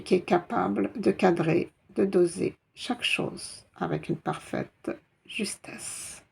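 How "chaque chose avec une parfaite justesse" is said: chuchoté. Real, not acted. Explication chuchotée.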